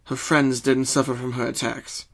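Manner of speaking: ordinary delivery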